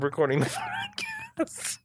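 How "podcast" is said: high squealing laugh. Real, not acted. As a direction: burst out laughing mid-word. Cracks up mid-sentence.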